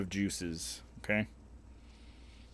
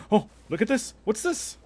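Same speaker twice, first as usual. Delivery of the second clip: much higher, louder playful dog-teasing voice; talking to a dog; 12 semitones higher, 7 dB louder than usual. Pretend. Jingling keys at the kid like a puppy, high and playful. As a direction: coo at him like you're playing fetch.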